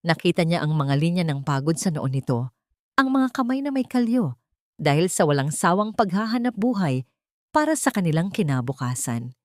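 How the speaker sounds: ordinary delivery, nothing marked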